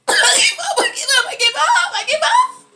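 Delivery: high, loud cries of giving up; repeated high yell of giving up; loud mock surrender. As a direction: shout it over and over, hammed-up.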